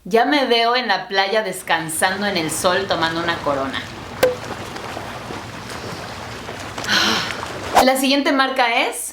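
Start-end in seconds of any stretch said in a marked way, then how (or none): 3.9-7.8 contented sigh. Pretend. Imagining relaxing on the beach.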